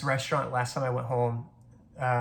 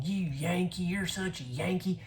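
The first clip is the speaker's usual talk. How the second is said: higher, drawn-out mock Southern taunt; needling older relative; 5 semitones higher, 3 dB quieter, slower than usual. Distant cousin needling a relative at a funeral, mock taunt. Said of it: "kept coming up to me and saying".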